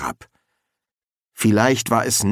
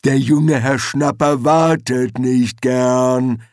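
slow, loud troll rumble; ponderous troll voice, dragged out; rumbling troll; 3 semitones higher, 5 dB louder, slower than usual. A narrator's reading. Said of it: "brummte Detritus".

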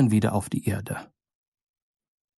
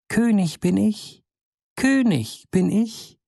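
much higher, slow piping cry; fine little voice; 8 semitones higher than usual. A narrator's reading. The little bird calls out of the mousehole, very high and slow. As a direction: crow it up thin out of a mousehole.

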